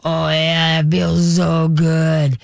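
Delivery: slow, drawn-out imitation of pleasure; blissful massaged dog. Host playing a dog getting finger massage, blissful drawl. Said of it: "just see 'em go".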